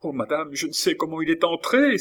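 high, rushed exclamation; frightened cry. A narrator's reading. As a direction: gabble it in alarm. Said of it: "s'écria Lorenza en se renversant".

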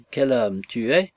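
slow, weighing each word; drawn out as she ponders; slow and thoughtful; slowly. Acted. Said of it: "dit lentement Fimouchka".

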